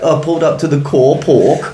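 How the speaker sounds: put-on South African accent. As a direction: quote the surf movie line in a South African accent. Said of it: "Or, like".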